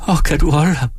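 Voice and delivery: high, anxious wail from the wife. A narrator's reading. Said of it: "jamrede hun". (jamrende, ængstelig kone)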